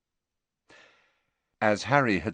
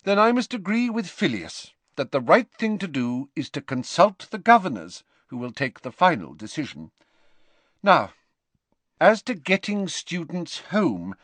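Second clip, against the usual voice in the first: stern headmistress; 9 semitones higher than usual. A narrator's reading. McGonagall lays out plans.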